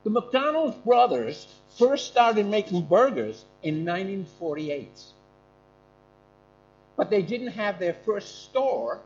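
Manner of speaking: put-on slow, drawn-out American accent; a mock American accent. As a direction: tell it like a folksy American. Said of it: "but I can do an American accent".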